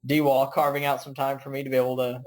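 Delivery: ordinary talk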